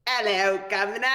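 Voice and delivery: hammed-up British greeting; British accent. Shown as a demonstration. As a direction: put on a rough British accent. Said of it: "if we're going British".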